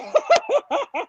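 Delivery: high re-enacted running laugh; manic laugh. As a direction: cackle as if fleeing in glee. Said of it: "I was laughing".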